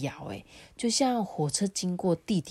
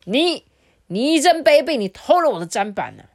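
much higher and much louder, faster at the end, hitting 偷; furious accusation; 8 semitones higher, 8 dB louder than usual. Acted. Older brother charging out to accuse his brother, furious. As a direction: spit out 你真卑鄙 like a cheated man.

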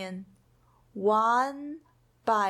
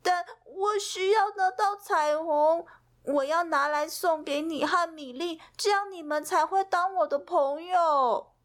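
sniffly; 7 semitones higher than usual. Acted. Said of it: "皮皮抽着鼻子说".

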